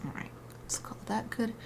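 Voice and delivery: soft whispered aside; whispering. Real, not acted. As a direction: whisper it to yourself. Quiet aside while painting.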